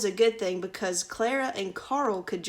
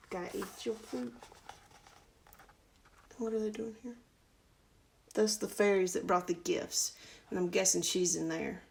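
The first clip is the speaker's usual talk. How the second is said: ordinary delivery, nothing marked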